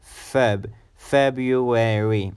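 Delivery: FEB alone, then FEB-ruary slowly; slow model of February stressed on FEB; first-syllable stress. Shown as a demonstration. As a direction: stretch February, weight on Feb. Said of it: "The emphasis is on the first syllable".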